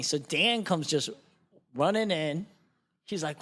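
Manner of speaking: ordinary delivery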